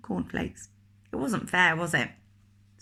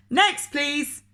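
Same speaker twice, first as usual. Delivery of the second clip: loud, high shouted next please; loud call; 9 semitones higher, 4 dB louder than usual. Acted. Grocer loudly calling the next customer. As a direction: call the next customer over his head.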